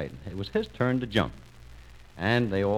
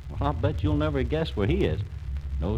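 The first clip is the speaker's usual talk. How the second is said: ordinary delivery, nothing marked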